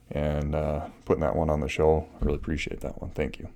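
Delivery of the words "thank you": normal talking voice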